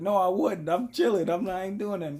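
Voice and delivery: high, insistent retold protest; defensive denial. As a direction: act out hurried innocence under accusation. His denial in retold quarrel.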